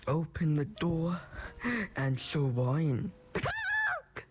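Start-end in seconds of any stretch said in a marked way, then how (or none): 3.3-4.3 much higher squawking chicken voice. Acted. Chicken's only word.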